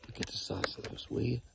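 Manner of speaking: whispered in awe at the antlers; soft whispered wonder; awed whisper. Real, not acted. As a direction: breathe it out, amazed. Marvelling at antlers.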